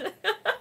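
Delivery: high, soft giggle; laugh. Real, not acted. Laughs about her accent.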